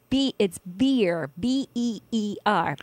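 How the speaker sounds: "beer" said slowly with a clear "ee" and then spelled "B E E R" letter by letter; careful spelling out. Shown as a demonstration. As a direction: slowly spell out "B E E R" so nobody hears "bear". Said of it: "A bear or a beer?".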